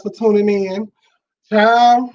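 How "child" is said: exclamation. Real, not acted. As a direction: call it out like big news. Exclaims before telling her night.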